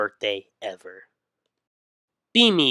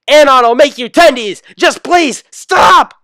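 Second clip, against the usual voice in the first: far higher, far louder pleading shriek; mom's terrified scream; 15 dB louder than usual. Acted. Mom screams at son to stop rolling on her. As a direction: shriek like a crushed mother begging.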